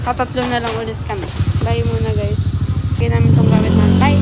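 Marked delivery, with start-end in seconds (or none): 1.6-4.2 loud goodbye. Real, not acted.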